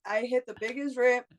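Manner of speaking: normal talking voice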